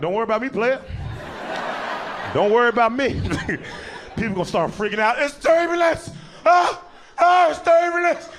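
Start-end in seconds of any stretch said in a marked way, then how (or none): 0.0-3.1 cocky. Acted. Cocky reply to passengers. 5.2-8.3 high panicked yells of fear; panicked screaming. Acted. Panicking passengers during turbulence.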